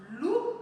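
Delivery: "loup" set apart, high and clear; demonstrated word. Shown as a demonstration. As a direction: model "loup" for listeners, lifting the "ou" sound. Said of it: "écoutez attentivement. Lorsque je dis le mot".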